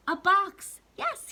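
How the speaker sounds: high, loud "A box. Yes,"; light up with the idea; papa bursting with a bright idea; sudden idea. Acted. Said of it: "Then Papa got an idea".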